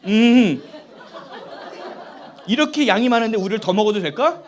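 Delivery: loud, laughing retelling; can barely talk for laughing; laughing while telling the milk story; laughing through it. Real, not acted. Laughing while retelling son exchange.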